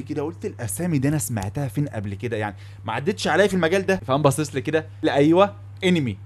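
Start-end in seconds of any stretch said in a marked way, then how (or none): none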